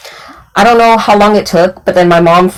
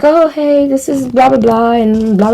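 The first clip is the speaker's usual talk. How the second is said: higher, bright introducing voice; breezy mom making introductions; 5 semitones higher than usual. Retold or imitated. Mom introducing her daughter to the new boyfriend, higher breezy voice.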